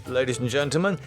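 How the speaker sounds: usual speaking voice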